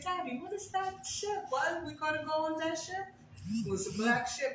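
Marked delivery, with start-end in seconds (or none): 0.0-3.1 put-on high, curious child's voice; a small child asking her father, lifted up; little girl asking daddy. Child asking daddy about the ship, high and curious. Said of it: "And I said".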